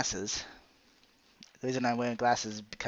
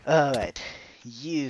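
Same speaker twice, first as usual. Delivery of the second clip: ordinary talk, nothing marked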